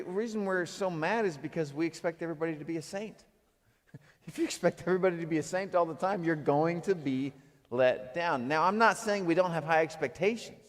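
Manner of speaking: ordinary talk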